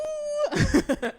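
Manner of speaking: high whooping cheer; excited cheer. Real, not acted. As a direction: whoop with a laugh. Cheering own collective.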